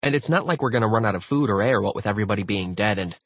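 hurried, pushy argument; insistent crewmate rambling. Acted. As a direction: barrel on until cut off. Sengupta presses on until cut off.

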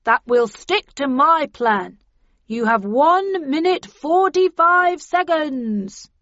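slow, loud, stretched announcement; deliberate shouted refusal; bullhorn ultimatum. A narrator's reading. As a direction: call out the countdown through a bullhorn. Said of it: "replied the voice on the bullhorn".

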